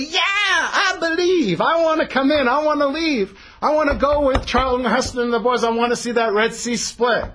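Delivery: a high, laughing, overexcited shout of a hanger-on; eager riffraff. Retold or imitated. The mixed multitude cheering to join the Exodus, high excited shouting. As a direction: play the gushing crowd member desperate to tag along.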